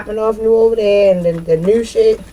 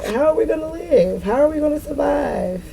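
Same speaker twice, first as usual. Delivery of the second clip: higher, whiny mock worry; mock panic; 7 semitones higher than usual. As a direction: ask how they'll survive as the scared crowd. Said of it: "I'm hearing this shit in my head".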